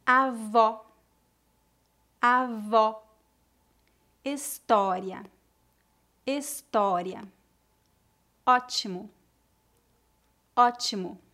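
Avó, História, Ótimo with an open /ɔ/. Shown as a demonstration. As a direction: open the mouth wide on the O. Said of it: "Pronúncia aberta".

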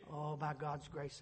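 usual speaking voice

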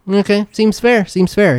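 light merchant agreeing to the deal; shopkeeper voice. Acted. Shopkeeper weighing gems, a lightly voiced pleased merchant. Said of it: "and he's like".

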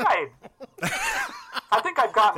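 joking squeaky-high pitch; ironic high voice. Pretend. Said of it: "that's what you call irony".

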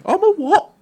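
mock Harry squeak. Harry shocked to hear he's a prince, squeaky mock disbelief. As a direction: play a baffled Harry in a squeaky voice. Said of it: "I went to Hagrid".

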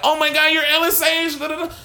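high-pitched gushing fan imitation; crying fangirl. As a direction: squeal it like a starstruck fan, mocking.

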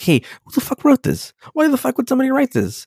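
high, fast, baffled mock questioning; mock confused villager. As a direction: squeak out the questions like a clueless follower. Mocking believers questioning religion.

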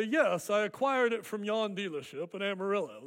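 hammed-up British accent, put-on and proper; mock British accent. Car owner answering a compliment on the new car, in a mock British accent. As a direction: do your best amateur British. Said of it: "That's my best British, uh, accent".

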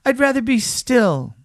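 high little rabbit protest; defensive high-voiced toy rabbit; reluctant little rabbit. A narrator's reading. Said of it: "he said".

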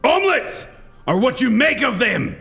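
Gerard Butler in 300. Acted. Comic impersonating Gerard Butler for a guessing game, shouting high. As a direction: bellow it like a Spartan king rallying troops. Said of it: "Is that Gerard Butler in three hundred?".

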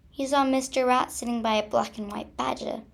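ordinary delivery

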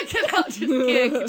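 loud, high laughing mock order. Real, not acted. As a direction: play along while cracking up. Laughs along with the joke.

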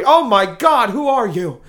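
sudden loud gasp of shock at the mirror; startled shock. Acted.

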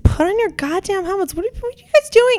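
high, exasperated scolding, rising to a yell; yelling at kids. Retold or imitated. Yelling at helmetless kids on bikes, exasperated and angry. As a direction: scold careless bikers like an angry parent. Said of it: "I had a physical reaction".

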